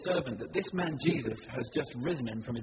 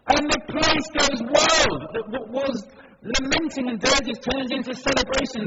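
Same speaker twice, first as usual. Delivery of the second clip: jubilant; 9 semitones higher, 10 dB louder than usual. A narrator's reading. Preacher telling how the town celebrates, jubilant. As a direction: burst out with the crowd's joy. Narrating the crowd's joy.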